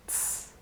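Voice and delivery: TS as pure hiss, no voice; unvoiced ts. Shown as a demonstration. Teacher demonstrating the voiceless sound, unvoiced ts. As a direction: let TS out with no vibration. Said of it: "no vibration".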